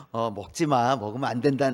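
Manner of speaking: gentle voice. Shown as a demonstration. Pastor acting a tender God warning Adam, gentle. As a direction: say it gently, like coaxing a child. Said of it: "부드럽게 말씀하셨을까요?".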